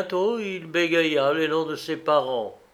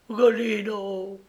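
higher-pitched, anguished call to her husband; a touch of alarm in the murmur; murmured distress; 6 semitones higher than usual. A narrator's reading. Wife sees her husband transformed, murmured distress. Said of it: "murmura l'infortuné".

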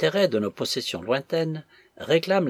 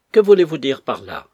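much louder, falling question; loud challenge; 10 dB louder than usual. A narrator's reading. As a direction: demand an explanation forcefully. Acted challenge to an insinuation.

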